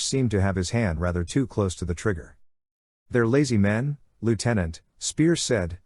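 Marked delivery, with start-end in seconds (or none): none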